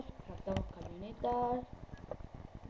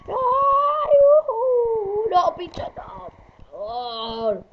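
high frantic yelling; panicked scream; 14 semitones higher, 14 dB louder, much slower than usual. Real, not acted. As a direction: shriek in panic, then plead slowly.

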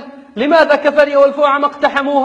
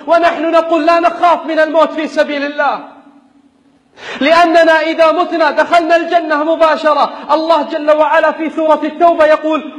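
raised, insistent sermon voice; fiery preaching; 9 semitones higher than usual. Acted. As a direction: preach it with rising fervor. Rousing sermon to fighters.

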